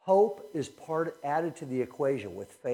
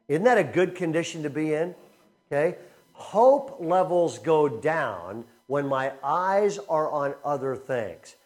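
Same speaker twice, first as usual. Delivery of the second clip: usual speaking voice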